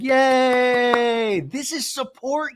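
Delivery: high, drawn-out mock cheer; cheering girls. Recapper mimics the housewives celebrating, high slow mock cheer. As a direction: stretch out a squealed celebration. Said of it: "all the girls are like".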